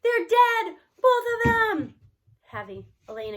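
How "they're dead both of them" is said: loud, high, breaking with grief; a boy crushed by a book's ending; grief-stricken. Acted. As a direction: wail it like the world ended. Boy grieving fictional dogs.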